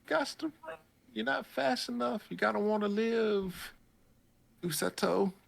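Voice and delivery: high, frantic cheering on a fleeing character; mock panicked yelling. Urging the fleeing boy on, high and frantic. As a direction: squeal it like a frantic fan. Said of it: "I'm like".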